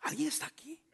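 susurrado, en broma, como buscando a alguien; susurro. Acted. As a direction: pregunta susurrada a la congregación. Checks if congregation is awake. (el pastor susurra a los hermanos para despertarlos)

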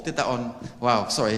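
ordinary talk

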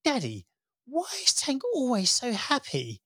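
put-on high, childlike voice for the son; little son. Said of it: "Sonny asked me".

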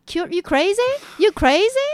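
high, loud disbelieving challenge; incredulous scolding. Retold or imitated. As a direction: challenge them as if they've lost their mind. Retold scolding of reckless friend.

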